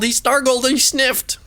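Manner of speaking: ordinary delivery, nothing marked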